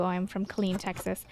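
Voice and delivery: ordinary delivery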